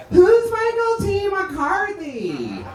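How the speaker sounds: high, slow mock-puzzled voice; mock puzzled girl. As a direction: play a clueless young dancer, drawn out. Imagined girl puzzled by the name.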